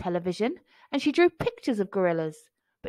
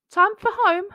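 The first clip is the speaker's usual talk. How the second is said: high, louder asking voice; gentle question; 10 semitones higher, 7 dB louder than usual. A narrator's reading. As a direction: lift it up, a kind prompt to leave. Gorilla asks gently.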